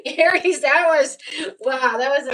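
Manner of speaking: sudden high, loud outburst of delight; excited burst. Real, not acted. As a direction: blurt it out, thrilled with the reading. Thrilled by her own reading.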